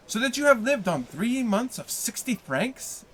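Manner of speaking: ordinary delivery, nothing marked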